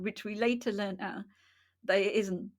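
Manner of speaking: normal talking voice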